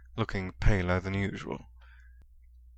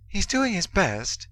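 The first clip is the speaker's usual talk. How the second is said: high, slow, earnest insistence; insisting; 11 semitones higher than usual. A narrator's reading. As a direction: press the point gently but firmly. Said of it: "Hermione insisted".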